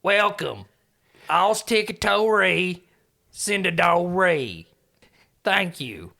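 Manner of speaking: slow, level creature speech; simple creature naming things word by word; drawn-out broken English in a put-on voice; Belle's voice. Belle greets the audience in broken speech.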